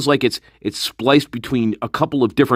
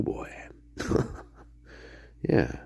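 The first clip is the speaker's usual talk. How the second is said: ordinary delivery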